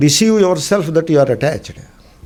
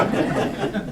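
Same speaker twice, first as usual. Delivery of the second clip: soft laugh; 9 dB quieter than usual. Real, not acted. Laughing at own joke.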